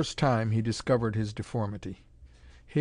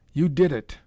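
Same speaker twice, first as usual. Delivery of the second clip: louder, higher, forced through the teeth; ground out in rage; 4 semitones higher, 7 dB louder than usual. Acted. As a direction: spit it with hate at the mad scientist. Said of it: "the Indian ground out".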